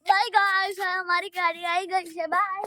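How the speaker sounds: loud cheerful goodbye. Real, not acted. Child waving off the viewers as the school van arrives, loud bye. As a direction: shout a happy bye as you leave.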